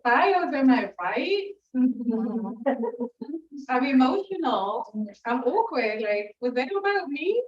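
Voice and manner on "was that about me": ordinary delivery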